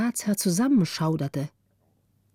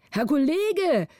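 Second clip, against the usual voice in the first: higher anxious call to a colleague; 7 semitones higher, slower than usual. A narrator's reading. Men behind call to the wobbling councillor, urgent call. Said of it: "riefen die hinter ihm".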